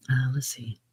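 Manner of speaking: whisper. Real, not acted. Whisper measured.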